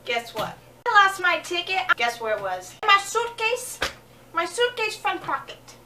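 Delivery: usual speaking voice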